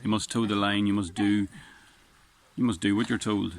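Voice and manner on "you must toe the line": ordinary talk, nothing marked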